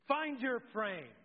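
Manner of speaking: a dance teacher barking a drill at the class; dance instructor. Retold or imitated. Scene: instructor lining up the class, slow mock command. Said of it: "and she goes".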